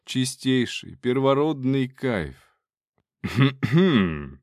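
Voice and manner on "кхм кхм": polite attention cough; throat clearing. Acted. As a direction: clear throat to get noticed. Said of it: "прокашлялся кто-то".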